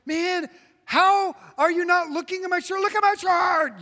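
hammed-up outrage at being ignored; mock frustrated shout. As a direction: yell at someone who won't look. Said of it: "you're like".

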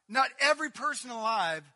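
loud and high, pressing the word every; loud preaching repeat. Acted. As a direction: proclaim it, hitting every. Repeated line, loud first take.

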